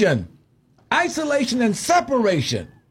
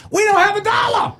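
shouting; 10 semitones higher than usual. Real, not acted. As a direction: shout the point down hard. Heated radio debate, host shouts.

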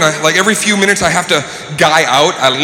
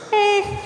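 unimpressed hum; 6 dB quieter than usual. Retold or imitated. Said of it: "I went like this".

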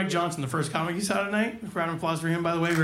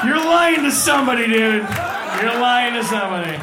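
shouted at the crowd, louder; loud accusation; 6 dB louder than usual. Real, not acted. Comic calling out the audience, loud shout. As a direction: shout it out, half laughing. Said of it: "That's how you know".

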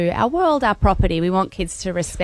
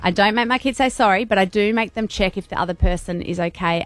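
ordinary talk, nothing marked

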